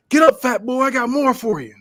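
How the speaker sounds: high, loud taunting shout; wrestler's yell. Acted. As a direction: yell it at a downed opponent. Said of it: "Rick yells".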